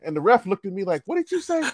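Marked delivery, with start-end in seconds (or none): 1.1-1.6 high-pitched disbelief, acted; incredulous ref. Ref questioning cocky player, acted disbelief. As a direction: imitate someone stunned by the cheek.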